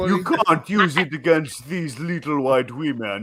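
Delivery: repeating the line in an imitated African accent; a retold punchline in an imitated African accent; thick African accent. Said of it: "in his thick African accent".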